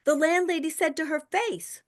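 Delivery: ordinary talk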